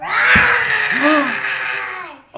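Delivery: high shrieking gasp of pretend fright; loud mock gasp. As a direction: gasp loudly, playing scared. Mock fright at puppet dragon.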